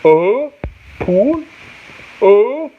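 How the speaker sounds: high questioning "Oh... pool?"; halting high try at "pool"; sounding out syllables. Shown as a demonstration. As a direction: lift "Oh" and "pool" high, one at a time. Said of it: "Is that how you say it".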